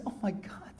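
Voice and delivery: hushed, high-pitched shock; soft breathy start rising very high; acted horrified realization. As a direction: voice a wife's dawning panic, softly. Wife finds her husband dead.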